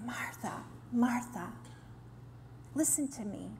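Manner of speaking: soft, whispered call to listen; gentle whisper. Retold or imitated. Jesus gently stopping Martha, in a soft whisper. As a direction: whisper her name to calm her. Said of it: "he's like".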